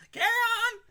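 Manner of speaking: very high, loud squeaky shouting, laughing; silly high voice. Pretend. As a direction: scream playoffs in a cartoon squeal. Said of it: "that voice of mine".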